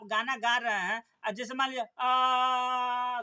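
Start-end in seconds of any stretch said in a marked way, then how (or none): 2.0-3.2 a held आ with no rise or fall in pitch; monotone aa. Shown as a demonstration. Teacher demonstrating a monotone voice to students, one flat held आ. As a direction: sing one steady आ, dead level. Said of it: "खाली आ कर रहे हैं".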